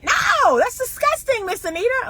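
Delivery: put-on very high, squealing disgusted girl; young girl recoiling in disgust; mock disgusted girl. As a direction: imitate a grossed-out young girl. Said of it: "the young girl answers".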